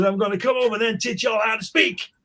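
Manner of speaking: high, mock-threatening American voice; older American stranger. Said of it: "he says".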